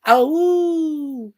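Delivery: high playful "Ow" howl; mock werewolf howl. Greeting the werewolf viewer with a playful howl.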